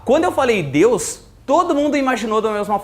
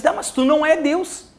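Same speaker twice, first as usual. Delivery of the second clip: skeptic; 8 semitones higher than usual. Retold or imitated. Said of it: "E aí os caras dizem".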